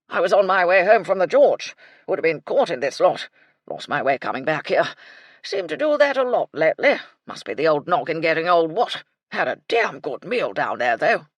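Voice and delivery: put-on loud muddled old colonel; an old soldier rambling on. A narrator's reading. Colonel telling about the pub, loud and rambling.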